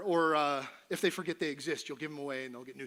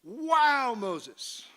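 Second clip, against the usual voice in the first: amazed; 7 semitones higher, 8 dB louder than usual. Real, not acted. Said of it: "kinda being a whiny little person".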